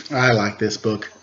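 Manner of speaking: usual speaking voice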